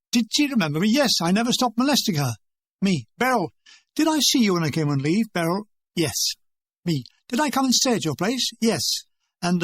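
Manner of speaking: usual speaking voice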